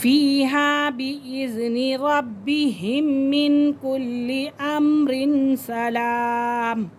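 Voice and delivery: slow melodic tajweed chanting; chanted verse with a breath pause; Quran recitation. Shown as a demonstration. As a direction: recite the verse like a qari, unhurried. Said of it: "या आप यहाँ सांस छोड़ें".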